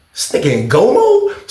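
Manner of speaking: high rising disbelief, last word stretched; incredulous question. Retold or imitated. Sizing up OG's fighting stance, incredulous. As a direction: climb sharply into a baffled question.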